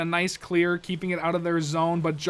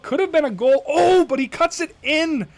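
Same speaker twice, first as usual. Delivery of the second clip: excited; 7 semitones higher, 7 dB louder than usual. Real, not acted. Near goal, live commentary.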